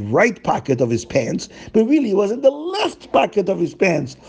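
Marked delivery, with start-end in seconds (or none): none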